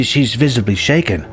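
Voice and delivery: ordinary delivery, nothing marked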